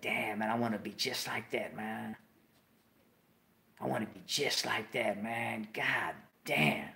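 soft, low, dreamy wishing; awed murmur. Retold or imitated. Kid dreams of being Steve, in a soft, awed hush. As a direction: breathe it out, lost in longing.

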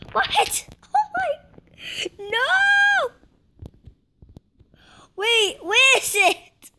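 very high, frantic squeals; panicked cry. Real, not acted. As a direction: panic as the item vanishes. Panicking over lost item.

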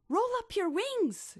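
high, bright urging; a keen friend's high call; eager instruction. Acted. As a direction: coax him with a lively plan. Shelley eagerly instructing Billy.

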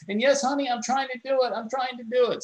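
high, pressured plea to a husband; berated mother. Retold or imitated. Hacker playing a berated wife to her husband, harried. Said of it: "acting like the husband's berating her".

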